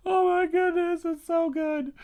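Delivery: loud, high, choked-up cry of joy; overwhelmed tearful outburst. Retold or imitated. Reading a picture book in Walmart, bursting out loud and tearful. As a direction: blurt it out like the book just broke you. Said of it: "I started crying, like".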